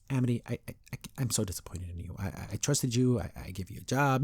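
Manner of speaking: soft, hesitant, stammering disappointment; meek big guy. Retold or imitated. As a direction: imitate a gentle boss, quietly stumbling over words. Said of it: "he's not as scary".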